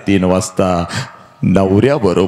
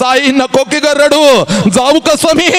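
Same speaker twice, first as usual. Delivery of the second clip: 1 pleading cry; 4 dB louder than usual. Acted. Bride weeps to mother.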